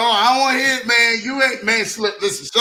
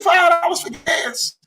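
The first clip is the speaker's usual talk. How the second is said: 1 mock begging; 6 semitones higher than usual. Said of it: "I'd be like".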